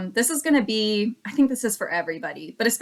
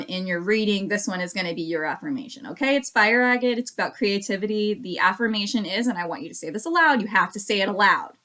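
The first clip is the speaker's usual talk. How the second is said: usual speaking voice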